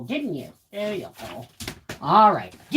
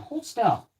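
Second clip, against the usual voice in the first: normal talking voice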